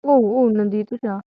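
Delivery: normal talking voice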